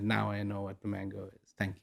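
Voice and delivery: hushed, soft and satisfied; quiet realization. Retold or imitated. King tastes the mango, says it hushed. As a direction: breathe it out softly, at peace.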